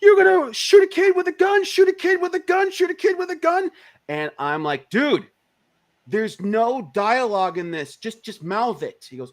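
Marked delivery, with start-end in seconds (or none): 0.0-3.7 very high, hammed-up screamed rant; mock screaming. Flash actor berates gunman on set, mock screaming. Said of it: "he's screaming at the top of lungs". 4.9-9.1 high-pitched, drawn-out exasperation; exasperated director. Retold or imitated. Director corrects screaming actor on set, exasperated.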